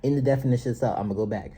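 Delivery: ordinary talk, nothing marked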